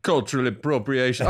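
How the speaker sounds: put-on low-pitched German-gone-Jamaican accent; comic botched accent that drifts into Jamaican; accidental Jamaican accent. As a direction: attempt a German, land on Jamaican. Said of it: "I don't know why they're Jamaican".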